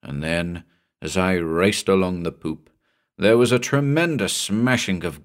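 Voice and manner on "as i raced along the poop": ordinary delivery, nothing marked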